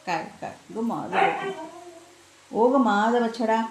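slow, soft cooing to a pet. Real, not acted. Owner soothing her beloved dog Waghya, tender coo. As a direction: coo lovingly at your little one.

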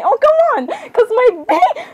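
high-pitched exasperated cry; squeaky, fed-up protest; exasperated outburst. Acted. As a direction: burst out in high, mock exasperation. Acted squabble, exasperated outburst.

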